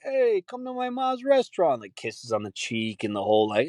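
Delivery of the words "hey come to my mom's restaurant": mock Italian-American guy. Italian guy inviting viewers to mom's restaurant, mock hearty greeting. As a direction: imitate an Italian social-media personality. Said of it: "Italian culture. ... all these guys".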